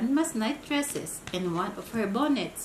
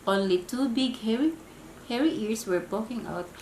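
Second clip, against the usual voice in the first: usual speaking voice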